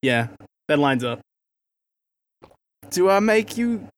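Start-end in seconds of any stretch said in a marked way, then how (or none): 2.9-3.9 high, loud groovy lumberjack voice; Austin Powers voice. Pretend. Austin Powers parody line.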